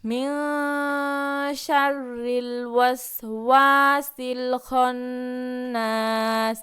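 slow, drawn-out recitation of the verse; slow Quran recitation. Acted. Teacher recites the verse slowly for pupils to repeat. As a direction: chant it slowly for the class to follow.

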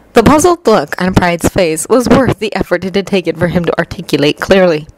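ordinary delivery

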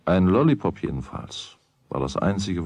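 plain reading voice

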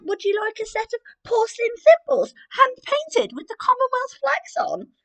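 put-on very high, loud childish voice; royal baby voice. Royal baby guessing a present for the queen: very high, loud. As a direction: squeak it like a royal toddler. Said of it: "guessed the royal baby".

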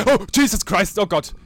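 loud, high burst of fright; startled cry. Real, not acted. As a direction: cry out in shock. Startled in the game.

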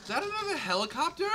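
high, surprised question; startled at the helicopter. Real, not acted. Surprised in game.

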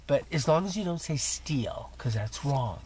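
whispered playful warning; mock secretive whisper. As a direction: lean in and whisper the joke. Conspiratorial joke about stealing.

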